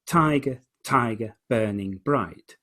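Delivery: "tiger" stressed on "ti", slow "burning bright"; trochaic stress. Shown as a demonstration. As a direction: lean on the first beat of "tiger". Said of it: "emphasis is on your first beat".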